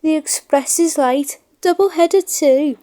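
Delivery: put-on a boy amazed on the platform; excited little boy. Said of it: "said one".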